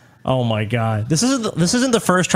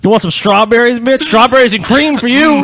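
strawberries and cream, called out louder and higher; hammed-up swaggering jeer, pushed loud; loud mock taunt; 6 semitones higher, 5 dB louder than usual. Friend hamming up a sleazy ballplayer taunt, loud and high.

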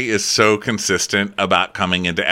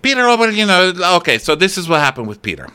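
high, loud start that settles as she explains; flustered excusing mother; 8 semitones higher, 3 dB louder, faster than usual. Retold or imitated. Mother defending her son to others, flustered. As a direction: imitate a mother scrambling to spin the story.

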